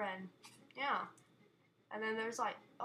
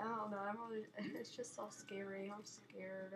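ordinary talk

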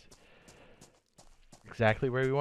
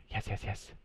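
hushed nervous agreeing; 11 dB quieter than usual. Real, not acted.

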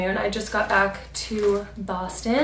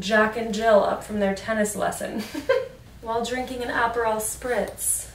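ordinary talk

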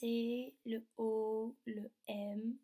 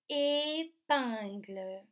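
stretched, slightly louder teaching word; drawn-out word; 5 dB louder, much slower than usual. Shown as a demonstration. Teacher naming the picture for children, drawn out. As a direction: say the word very slowly, sound by sound. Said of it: "Est-ce qu'on entend le son".

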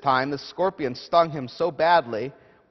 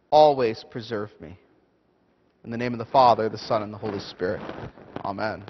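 prayer; 5 semitones lower than usual. Acted. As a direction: pray it reverently to the Lord. Opening prayer.

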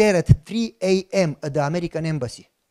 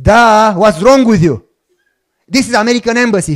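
mock exasperated; 3 semitones higher, 8 dB louder than usual. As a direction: blurt it like it's obvious, loudly. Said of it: "And I said".

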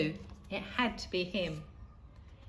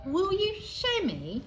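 put-on eager child; 12 semitones higher, 4 dB louder than usual. A narrator's reading. Leon asks the boy eagerly.

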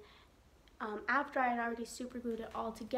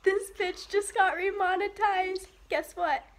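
louder, higher, gleeful; excited; 4 semitones higher, 4 dB louder than usual. Real, not acted. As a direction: gloat about getting paid. Excited remonetization.

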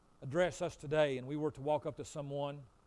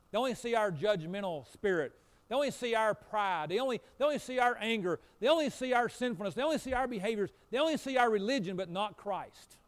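louder, rising insistent sermon cadence; preaching at full force; 6 semitones higher, 8 dB louder than usual. Real, not acted. Pastor to congregation on their sins, fervent preaching. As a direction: hammer each line like a preacher. Preaching builds on repeated line.

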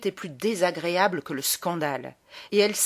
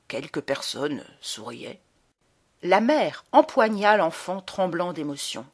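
ordinary delivery

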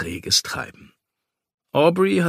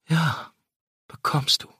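breathy moaned yes; 4 semitones higher than usual. A narrator's reading. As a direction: sigh it out, yielding. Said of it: "stöhnte sie".

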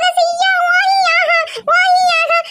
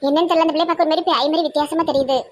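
put-on much lower, grumbling old auntie; low old village woman; 12 semitones lower, faster than usual. Old auntie frets to her sister about the pickle jars, low gruff voice.

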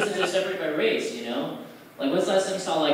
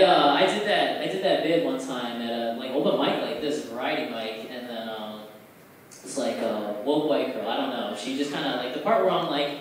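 ordinary delivery

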